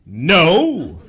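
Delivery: loud, emphatic heroic refusal; put-on superhero voice, drawing out the word. Shown as a demonstration. Superhero turning down a hooker, emphatic heroic voice. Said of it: "this is my impression of a superhero".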